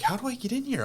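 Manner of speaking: high-pitched mock exasperation at normal volume; high, baffled mock complaint; retold frustration. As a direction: act out getting frustrated, pitch jumping way up. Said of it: "I'm getting really frustrated".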